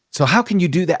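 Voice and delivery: a touch of outrage in retelling; retold scolding. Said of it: "I'm tearing into her".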